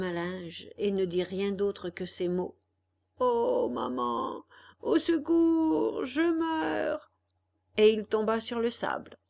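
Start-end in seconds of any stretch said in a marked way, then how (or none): 3.2-7.1 faint, stretched-out cry for help; dying cry. Acted. Struck boy cries to his mother, faint and dying. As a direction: gasp it out as you collapse.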